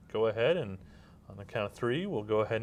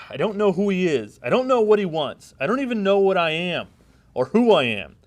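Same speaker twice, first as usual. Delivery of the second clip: raised, loud despair; anguished confusion; 7 semitones higher, 8 dB louder than usual. Acted. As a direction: cry out your lost identity. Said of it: "And scene".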